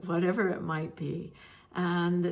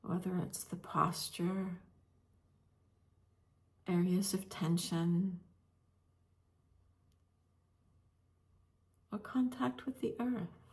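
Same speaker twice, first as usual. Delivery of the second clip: guided meditation. Real, not acted. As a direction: gently guide listeners into stillness, leaving space between phrases.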